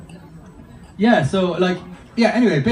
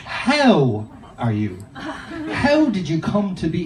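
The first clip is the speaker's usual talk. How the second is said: philosophical; much slower than usual. Acted. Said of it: "It's so philosophical".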